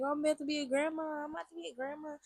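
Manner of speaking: high, singsong imitation of an excited mom; mock-excited squeal of a soon-to-be grandma; retold excitement. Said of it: "she was just more excited".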